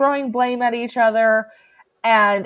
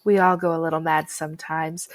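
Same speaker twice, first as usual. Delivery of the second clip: low, soft and eerily calm, lower and softer than the speaker's usual talk; creepy calm killer; 5 semitones lower, 6 dB quieter than usual. Retold or imitated. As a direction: murmur it like a killer who has nothing left to hide. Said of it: "he says".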